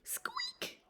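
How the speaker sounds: tiny, very high squeak; mouse squeak. A narrator's reading. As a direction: squeak softly like a hidden mouse. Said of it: "when he heard a noise".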